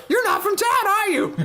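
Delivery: put-on very high little girl asking. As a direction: squeak it like a small child. Voicing the girl character.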